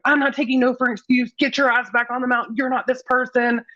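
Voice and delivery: lightly voiced bossy friend ordering her back up; best friend nagging her up the mountain, hammed-up demand; Tiger Mom. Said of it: "we call her Tiger Mom".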